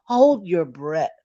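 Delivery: loud, slow robotic order; loud, slow mechanical command; machine command. Retold or imitated. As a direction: bark it like a scanner voice. Voicing the machine.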